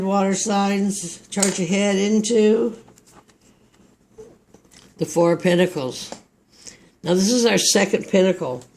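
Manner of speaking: usual speaking voice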